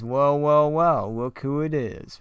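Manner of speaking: a lightly voiced smug villain relishing the moment; sly gloating greeting. Disguised Bruce taunting captured Robin, smug and drawn out.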